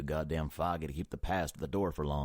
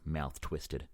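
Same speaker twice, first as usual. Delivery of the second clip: ordinary delivery, nothing marked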